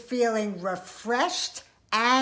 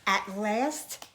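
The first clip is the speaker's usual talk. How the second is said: ordinary delivery, nothing marked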